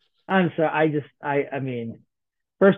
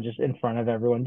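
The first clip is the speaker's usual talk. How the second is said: ordinary talk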